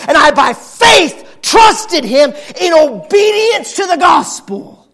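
loud, driving preacher voice; full-force preaching. Acted.

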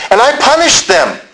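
high, emphatic declaration; forceful testimony. Acted. Paul testifying to Agrippa about persecuting believers, forceful. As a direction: punch out a confession high and hard.

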